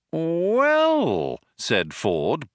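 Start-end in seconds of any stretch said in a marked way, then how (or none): 0.1-1.4 high, long drawn-out opener; bright but slow. Acted. Ford about to suggest an alternative, brightly but slowly. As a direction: stretch it out brightly. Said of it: "brightly but slowly".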